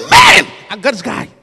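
booming proud voice of God; God speaking. Acted. God delights in Zacchaeus, shouting. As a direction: shout it as God claiming his man.

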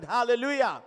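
loud hallelujah to the congregation; preacher's call. Real, not acted. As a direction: call out for a response. First call for congregation's response.

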